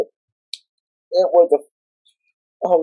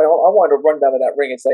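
ordinary talk

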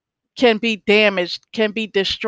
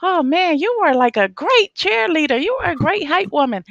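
grateful customers; 8 semitones higher than usual. Retold or imitated. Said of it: "They're like".

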